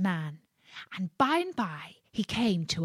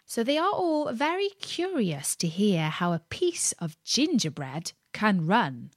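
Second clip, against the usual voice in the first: usual speaking voice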